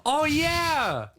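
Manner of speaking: gasp, then high "oh, yeah!"; excited recognition. Real, not acted. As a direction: burst out as the memory clicks. Suddenly recognizes the movie.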